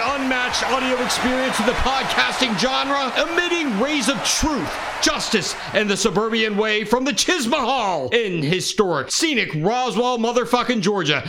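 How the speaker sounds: booming hype-man intro; big show announcer. Acted. Podcast host hype intro.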